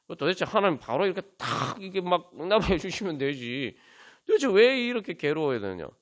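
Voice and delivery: exasperated "why must it be like this"; frustrated complaint. Acted. Acting out frustrated complaint to God.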